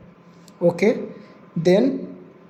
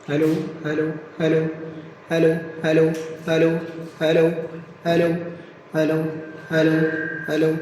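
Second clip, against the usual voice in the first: hellos heard back through the PA speakers; mic check over speakers; 3 semitones lower, 3 dB quieter than usual. Real, not acted. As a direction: test the mic with short repeated hellos. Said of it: "आप मेरी आवाज़ सुन सकते हैं". (Speaker से आती mic test की आवाज़)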